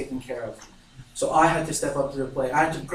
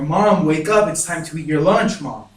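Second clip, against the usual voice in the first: louder, urgent re-enacted plea; growing worry; 5 dB louder than usual. Child shaking unresponsive mother to wake her, worried and insistent. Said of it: "now I'm starting to worry".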